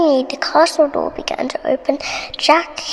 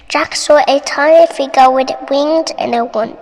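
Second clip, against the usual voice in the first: usual speaking voice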